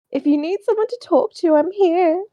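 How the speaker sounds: high, mock-caring voice; mock sincere voice. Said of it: "And then Colson says".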